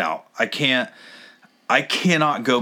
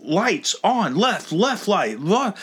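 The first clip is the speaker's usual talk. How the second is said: higher, slow retold orders; mock yelled commands; 7 semitones higher, slower than usual. Worker ordering a driver to set his lights, mock yelling. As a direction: mimic barked orders without real volume. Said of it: "She's yelling at me".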